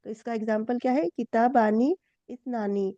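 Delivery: normal talking voice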